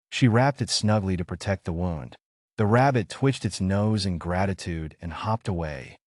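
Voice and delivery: plain reading voice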